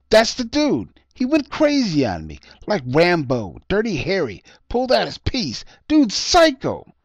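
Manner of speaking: high, loud ranting accusation. A narrator's reading. Homeless man rants to the cop, high and loud. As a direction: rant wildly, pointing him out. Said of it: "the homeless man ranted".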